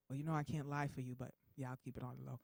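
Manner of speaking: deep, quietened voice, confiding; God. Acted. God agrees to keep the patient's secret, low and hushed. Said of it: "I hear this deep voice".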